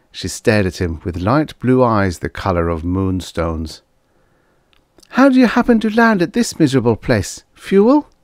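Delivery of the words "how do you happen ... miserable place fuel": quick, high-pitched female questioning; young woman's voice. Acted. Waitress asks why the trucker landed here, girl's voice. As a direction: ask briskly in a high girl's voice.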